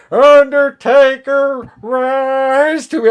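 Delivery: high, slow, eerie summoning voice; Paul Bearer impression. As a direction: call the Undertaker up from the grave. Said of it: "he's like".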